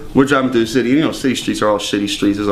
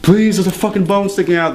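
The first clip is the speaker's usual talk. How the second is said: desperate plea; 8 semitones higher than usual. Retold or imitated. To hospital staff with a broken arm, higher begging plea. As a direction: beg the front desk to hurry. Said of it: "I roll in there like".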